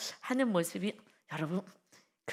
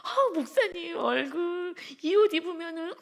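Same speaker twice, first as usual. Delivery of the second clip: high, loud whiny plea with a laugh; pleading young woman; 8 semitones higher, 4 dB louder than usual. Retold or imitated. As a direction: beg him not to wear it, voice way up. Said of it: "했더니". (높고 크게 조르는 자매 목소리)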